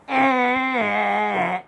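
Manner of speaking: high, long held bleat; answering cry. Acted. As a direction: hold a high cry back at the dragon. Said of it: "And I go".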